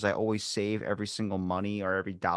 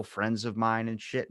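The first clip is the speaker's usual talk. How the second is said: normal talking voice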